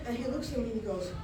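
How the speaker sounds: ordinary delivery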